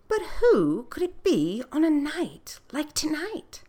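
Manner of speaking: high, wondering royal lady; high queen voice. Acted. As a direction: float it up like a curious queen. Queen wondering who knocked.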